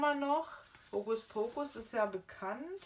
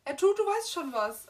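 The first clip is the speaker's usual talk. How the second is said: mock Dudley wail; 8 semitones higher, 9 dB louder than usual. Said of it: "im Englischen ist das mehr so wie".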